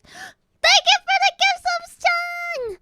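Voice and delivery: high, loud burst of thanks with a gasp; suddenly thrilled, thanking a viewer for a gift; excited thanks. Real, not acted.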